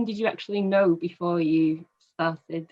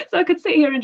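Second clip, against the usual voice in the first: higher, louder and fast through laughter; laughing; 8 semitones higher, 8 dB louder, much faster than usual. Real, not acted. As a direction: joke while still laughing. Joking while laughing.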